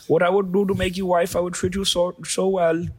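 high-pitched imitation Indian accent; mock Indian accent. Playing a polite online suitor, mock Indian accent. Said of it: "They're always polite".

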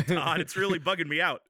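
normal talking voice

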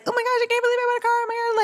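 very high, rapid-fire gushing; mock freaking out. Imagining herself overexcited about buying her dream car, mock freak-out. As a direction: squeal it breathlessly fast, hammed-up excitement. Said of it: "freaking out about buying this car".

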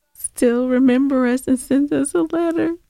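normal talking voice

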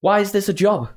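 high, rising panic over her own job; panicked rant. Retold or imitated. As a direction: spiral into comic panic. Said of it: "That's a little complex that I have".

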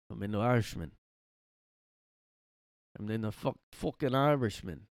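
lightly put-on Irish accent; mock Irish accent. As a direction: play at being an Irishman, accent and all.

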